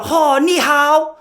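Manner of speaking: high, loud, drawn-out Chinese greeting; hammed-up Chinese accent to a child; mock Chinese accent. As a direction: stretch it out in exaggerated Chinese tones. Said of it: "go up to a little Chinese kid".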